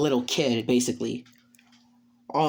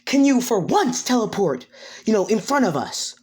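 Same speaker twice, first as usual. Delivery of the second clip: higher, exasperated jolt; high-pitched startled scolding; startled complaint; 8 semitones higher than usual. Acted. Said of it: "She gets startled again and goes".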